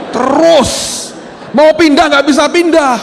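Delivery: high, loud, exasperated, with a laugh; a fed-up cry that laughs at itself; exasperated outburst. Retold or imitated. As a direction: burst out in laughing frustration. Exasperated at the nonstop praying.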